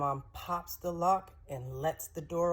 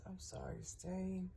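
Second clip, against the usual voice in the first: hushed, tender I'm sorry; soft apology; 3 semitones higher, 9 dB quieter than usual. Retold or imitated. Dad hugging his hurt daughter, soft hushed apology.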